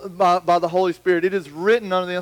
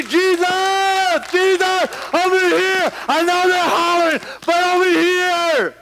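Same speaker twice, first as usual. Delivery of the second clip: high shouted plea for attention; shouting for Jesus; 11 semitones higher than usual. Retold or imitated. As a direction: holler to be noticed over the crowd. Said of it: "get your own shouting contest".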